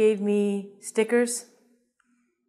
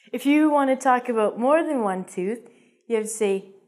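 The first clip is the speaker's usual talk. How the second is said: ordinary talk, nothing marked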